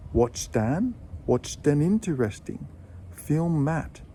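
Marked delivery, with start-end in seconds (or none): 0.1-2.7 "watched an" joined, T into "an"; linked watched an. Shown as a demonstration. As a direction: say "watched an" as one word. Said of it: "followed by a vowel sound".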